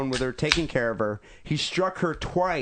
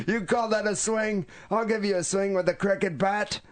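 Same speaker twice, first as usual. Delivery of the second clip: put-on 1 scornful old mother; 7 semitones higher than usual. Voicing mother mocking swing.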